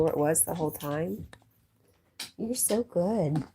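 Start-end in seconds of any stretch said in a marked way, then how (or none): 2.4-3.4 slow gushing praise. Real, not acted. Praising the dog.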